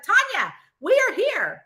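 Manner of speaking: high, insistent summons; retold exasperated phone call; impatient mom. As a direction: lightly mimic a mother calling you out.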